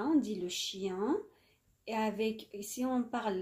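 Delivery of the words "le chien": le chien slow, nasal ending, no N; N silent. Shown as a demonstration. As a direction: spell out le chien slowly, N kept silent. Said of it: "on ne le prononce pas". (le chien ببطء بدون نطق النون)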